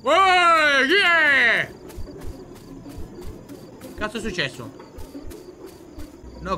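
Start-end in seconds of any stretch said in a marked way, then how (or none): 0.0-1.7 high, loud holler; long, loud challenge to a stranger; calling out. Real, not acted. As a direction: shout to someone far off, voice pitched right up.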